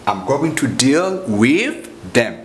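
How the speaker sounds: slow, each word of "I'm going to" spelled out; slow and deliberate, for learners; slow careful full form. Shown as a demonstration. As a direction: teach the long form slowly. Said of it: "It's like".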